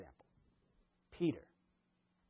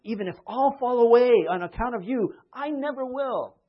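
higher and louder, slowing on the vow; bold vow; 7 semitones higher, 11 dB louder than usual. Retold or imitated. Peter vowing loyalty at the supper, loud and high. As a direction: declare it boldly.

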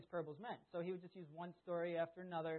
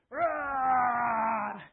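loud, long raised-pitch roar; gorilla roar; 8 semitones higher, 18 dB louder than usual. Retold or imitated. Oldest brother jumping out in gorilla mask, loud scary roar. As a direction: roar to make someone jump. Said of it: "he has this ugly gorilla mask".

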